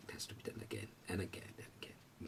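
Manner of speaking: breathy whispered repetition; teacher धीमे से फुसफुसाती है. Real, not acted. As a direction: fade to a hushed whisper, slowly. Teacher ends lesson.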